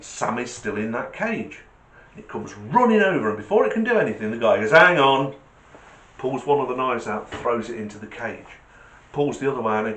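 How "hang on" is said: loud, abrupt hold-it call; acted raised-voice interruption. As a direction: call out to stop the gorilla. Guy calls out to gorilla.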